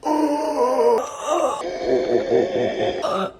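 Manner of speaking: loud terrified gasp; fainting client waking up, terrified gasp; panicked gasp. Retold or imitated. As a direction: gasp in sudden terror. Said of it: "she's just like".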